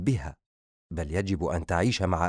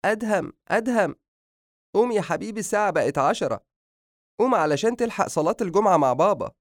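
high-pitched, singing wake-up call from a mother; motherly voice, pitched well up; mother waking son; 10 semitones higher than usual. A narrator's reading. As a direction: coax a sleeping son awake, voice lifted high. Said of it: "وبدأت توقظه".

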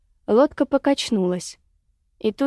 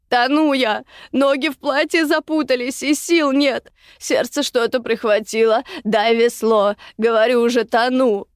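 raised, urgent cry for help; desperate plea; 4 semitones higher, 3 dB louder than usual. A narrator's reading. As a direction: plead urgently as you go under. Drowning woman begs for the oar.

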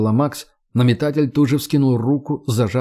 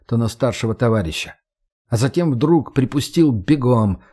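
usual speaking voice

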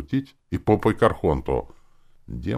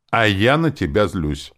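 higher, louder angry retort; spiteful hissing; 5 semitones higher, 7 dB louder than usual. A narrator's reading. Demon refusing to make peace, spiteful angry retort. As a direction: spit it back with spite. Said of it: "Демон злобно прошипела".

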